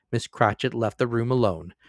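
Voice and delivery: ordinary delivery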